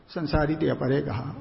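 ordinary talk, nothing marked

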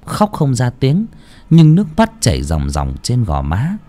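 ordinary delivery

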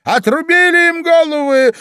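very high, loud shrieked question; shouting queen. A narrator's reading. Queen checks on her soldiers' executions, shouting. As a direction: yell it imperiously at the troops. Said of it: "крикнула королева солдатам".

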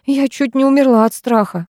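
plain reading voice

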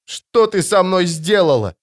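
high-pitched, anguished accusation; bitter, wounded outburst. A narrator's reading. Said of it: "горько спросил он".

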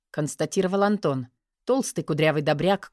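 ordinary delivery, nothing marked

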